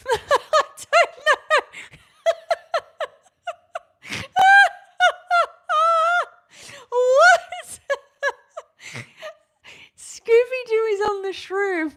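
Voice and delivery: squealing high laughter through the words; laughing hard. Real, not acted. Reading a comment about Scooby-Doo, laughing high and loud. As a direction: she can't stop laughing at a silly comment.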